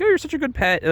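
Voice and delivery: high, sugary condescension; high mock-sweet coo; patronizing girly voice. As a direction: coo it like praising a dog. Kathy patronizing Heathcliff.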